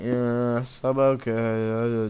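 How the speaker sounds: slow, flat-sounding depressed demo; bored. Shown as a demonstration. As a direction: drag it out like you're depressed. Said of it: "I sound bored and depressed".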